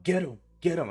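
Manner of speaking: high, loud urgent command; re-enacted shout to a guard dog; yelling to dog. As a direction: yell it out to set the dog off. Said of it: "So I just yell".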